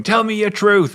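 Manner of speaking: high, loud drawn-out demand; mock shout. Tree shouting at the boy for his truth, mock shout. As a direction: bellow it like an angry tree. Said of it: "the tree is shouting at him".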